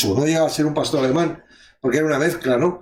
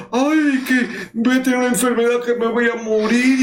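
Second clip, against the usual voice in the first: mock fright; 7 semitones higher than usual. Mimicking a frightened believer.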